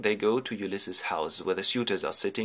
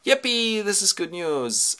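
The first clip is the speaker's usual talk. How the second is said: high mock-jubilant yippee, then slower; gleeful cheer; 10 semitones higher, 3 dB louder, slower than usual. Ulysses offered a ride home, gleeful cheer. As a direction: cheer like a delighted hero.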